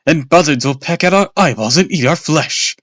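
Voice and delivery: loud, gleeful doom voice; gruesome grin. A narrator's reading. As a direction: ham up the spooky prophecy. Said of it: "with a gruesome grin".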